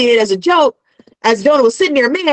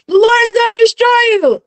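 higher-pitched street preacher's warning, only half yelled; retold yell, raised in pitch more than volume; mock yelling; 8 semitones higher than usual. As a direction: imitate someone yelling a warning at a crowd. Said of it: "He's yelling, he's screaming".

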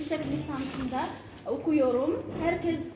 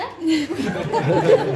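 laugh; 8 dB louder than usual. Real, not acted. Laughing at own joke.

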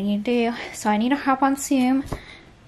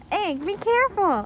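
high, bright cautioning voice; mock warning; 8 semitones higher, 4 dB louder than usual. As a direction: warn her in a high voice. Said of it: "When she tells you to be careful".

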